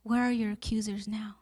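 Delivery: soft whispered question, asked twice. Acted. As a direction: whisper it close and tender. Jesus speaks gently to woman.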